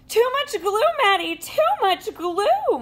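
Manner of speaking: high, squawking teacher voice. Acted. Teacher panicking at glue-covered Maddie, high squawk. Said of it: "my teacher squawks".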